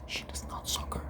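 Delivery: whispered aside. Real, not acted. Vlogger whispering a jab at soccer. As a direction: drop to a whisper on the joke.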